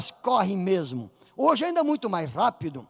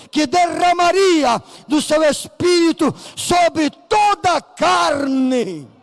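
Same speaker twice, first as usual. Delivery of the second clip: slow shouted climax; 5 semitones higher, 6 dB louder, slower than usual. Retold or imitated. Peter closing his sermon to the multitude, slow shout. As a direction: stretch each word out, loud and high.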